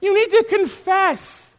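high, loud, heated insistence; impassioned acted scolding; passionate rebuke. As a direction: drive it home, worked up. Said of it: "I'm getting a little passionate".

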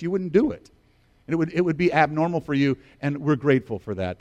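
normal talking voice

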